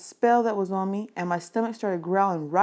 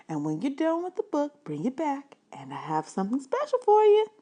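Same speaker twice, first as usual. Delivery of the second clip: librarian voice; 8 semitones higher than usual. Acted. Librarian telling the boy she recognized him, higher lady's voice. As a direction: play the friendly librarian recalling him.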